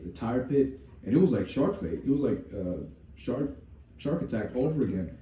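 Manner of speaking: usual speaking voice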